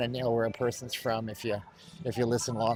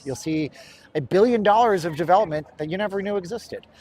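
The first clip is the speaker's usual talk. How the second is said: louder, higher channel-promo delivery; sponsor spot pitch; 5 semitones higher, 5 dB louder than usual. Real, not acted. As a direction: pitch the channel to camera with energy. Delivers his sponsor spot to camera.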